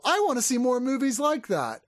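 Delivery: high mock kid's voice, eager; imagined kid. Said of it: "They're gonna watch this and go".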